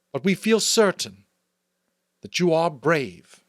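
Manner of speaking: solemn elder's public proclamation; chief elder's address. Acted. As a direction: speak up to the whole community with ceremony.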